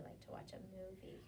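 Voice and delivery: soft cozy whisper; cozy hushed whisper. Real, not acted. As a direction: whisper it warmly.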